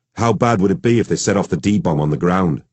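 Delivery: ordinary delivery, nothing marked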